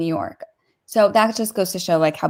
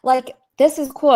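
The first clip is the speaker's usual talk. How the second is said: higher and louder, eager comfort; excited reassurance; 6 semitones higher, 4 dB louder than usual. Real, not acted. Co-host reassures emotional host, excited and loud. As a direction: cheer her on warmly.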